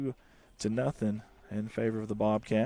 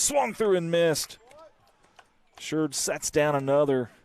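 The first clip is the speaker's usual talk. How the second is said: excited strikeout call; 5 semitones higher, 6 dB louder than usual. Real, not acted.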